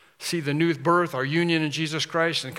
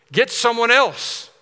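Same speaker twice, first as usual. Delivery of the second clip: higher, louder, slow and emphatic; exasperated refusal; 7 semitones higher, 7 dB louder, slower than usual. Retold or imitated. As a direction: refuse firmly, drawing out each word. Moses refusing God's call.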